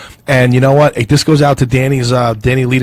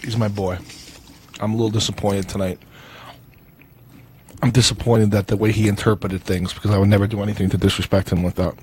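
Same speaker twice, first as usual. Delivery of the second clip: softer, low, heartfelt plea to a friend; sincere message; 4 semitones lower, 5 dB quieter than usual. Acted. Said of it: "it's gotta be sincere".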